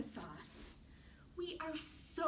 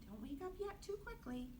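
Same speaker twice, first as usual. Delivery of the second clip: usual speaking voice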